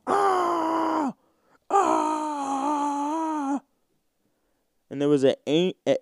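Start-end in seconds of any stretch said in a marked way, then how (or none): 0.1-3.6 high mock screams; screaming lady. Stand-up mimicking a street lady's high wailing scream. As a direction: imitate a crazy lady's high wail. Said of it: "she was just going".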